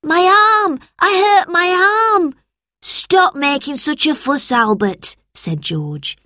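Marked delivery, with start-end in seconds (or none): 0.0-2.3 loud, very high pained wail; hurt little boy crying out. A narrator's reading. Albert wails about his arm after the fall. 2.8-5.2 put-on higher, exasperated boy voice; scolding older brother. A narrator's reading. George scolds Albert for fussing.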